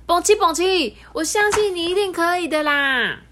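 put-on high, loud child's voice; children encouraging the little train; child cheering. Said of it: "小朋友们就拍拍他说".

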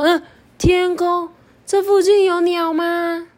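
high, slow, nervous little voice; timid bug glancing up; worried caterpillar. Acted. Bird-fearing Doudou looks up.

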